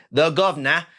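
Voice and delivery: bad Cockney accent, "Governor" as "Guv'nor"; hammed-up Cockney accent with a rising end; terrible Cockney accent. Shown as a demonstration. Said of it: "if I'm gonna use my terrible Cockney accent".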